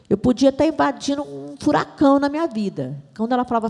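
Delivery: ordinary talk, nothing marked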